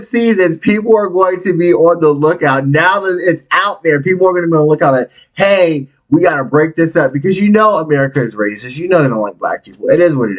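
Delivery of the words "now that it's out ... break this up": ordinary talk, nothing marked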